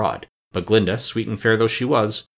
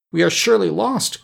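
high-pitched, understated despair; narrated despair, pitched well up; despairing cry; 10 semitones higher, 3 dB louder than usual. A narrator's reading. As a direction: cry out as if all is lost. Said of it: "cried Ginger in despair".

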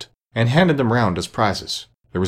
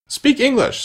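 high, sharp retort; sharp demand; slower than usual. A narrator's reading. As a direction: snap it back, high and indignant. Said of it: "said the eaglet".

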